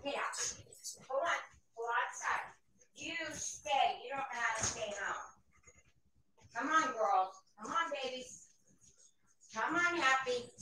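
faint voice from another room, herding pets; calling dogs, off mic. Real, not acted. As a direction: talk to your dogs from far across the house. Steps away to let dogs out.

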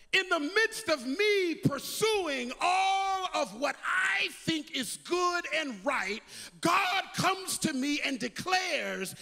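full-force preaching. Acted. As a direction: lift it and drive it home.

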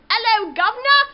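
loud, high mock British greeting; British accent. Shown as a demonstration. Demonstrating a British accent to viewers, loud hammy British hello. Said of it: "talk with a British accent".

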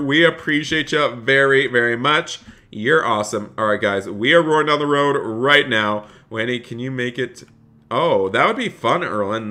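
ordinary delivery

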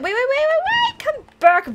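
rising high-pitched squeal; squeaky alarmed calling after a pet; urgent pleading. Real, not acted. Dragon is getting away.

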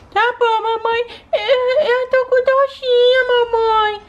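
put-on slow, high, whimpering child voice; a sick little daughter complaining to mommy; whiny sick little girl. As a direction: whine to mom about the pain.

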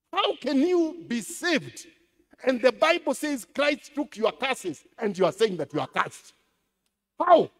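loud, high, incredulous rebuke; mock rebuke. Pastor retelling how he rebukes a visitor, loud and high. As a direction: scold them in disbelief, half sung. Rebuking a congregant who claims curse.

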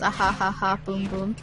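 ordinary talk

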